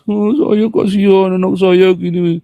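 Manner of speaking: slow, whiny beggar's plea; mock pleading sob-story voice, slow and drawn; pitiful pleading. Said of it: "Dia memelas-melas".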